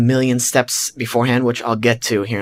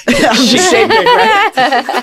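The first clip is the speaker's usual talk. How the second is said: laughing high through the words; giggling at his own nerves; 6 dB louder, much slower than usual. Real, not acted. Telling friends how nervous he was, laughing.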